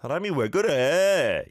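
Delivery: a quick, exasperated "사람이 왜 그래?"; natural exasperation. Shown as a demonstration. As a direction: echo it back with natural annoyed intonation. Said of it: "good natural intonation".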